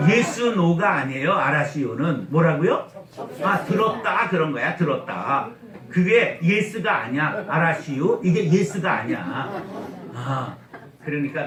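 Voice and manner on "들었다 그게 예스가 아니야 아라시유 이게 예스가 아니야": ordinary talk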